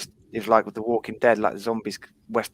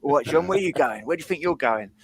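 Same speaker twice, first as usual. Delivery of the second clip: higher-pitched mock taunt, not shouted; imitating rival fans' call; 5 semitones higher than usual. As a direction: call out like a taunting football fan.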